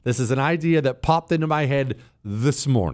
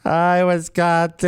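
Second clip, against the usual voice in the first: slow, louder gushing hello; mock suburban greeting; 4 semitones higher, 4 dB louder, slower than usual. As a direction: ham up the chipper greeting. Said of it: "speaking with a suburbia accent".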